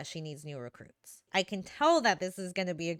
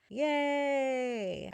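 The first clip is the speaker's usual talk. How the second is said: a bright, higher little yay; excited cheer; 6 semitones higher than usual. Real, not acted. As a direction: cheer for the viewers. Cheerful vlog greeting to viewers.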